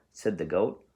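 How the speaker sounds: ordinary delivery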